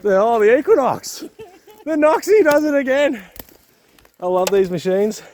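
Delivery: loud, high-pitched real elation; excited cheering. Real, not acted. Prospector cheering his mate's gold find, excited shout. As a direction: whoop it out over a lucky find. Friend's detector finds gold nugget.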